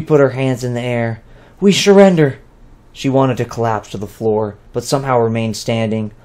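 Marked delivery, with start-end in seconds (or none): 1.6-2.4 louder and higher, called out; calling surrender. Acted. Two calls out to the soldiers, louder and higher. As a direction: call it out so the soldiers hear. Two gives up, hands raised.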